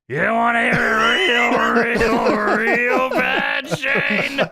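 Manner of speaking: high, loud, slow drawn-out taunting yell; hammed-up Funk taunt. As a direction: stretch each real as a mock rallying cry. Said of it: "Funk looks at him again and says".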